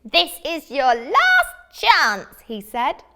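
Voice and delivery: slow, loud, rising to a sung high wail; menacing wizard threat. Acted. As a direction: draw out the threat, ending in a shrieking sing-song.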